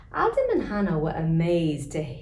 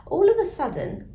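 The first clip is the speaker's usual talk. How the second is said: sudden alarm; 13 semitones higher, 6 dB louder than usual. A narrator's reading. Narrator springs the sudden turn with alarm. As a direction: jump up high and loud on it. Narrator builds suspense, very high.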